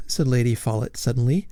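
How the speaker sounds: plain reading voice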